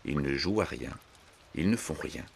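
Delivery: ordinary delivery, nothing marked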